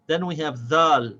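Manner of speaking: ordinary delivery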